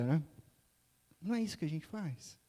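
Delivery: ordinary talk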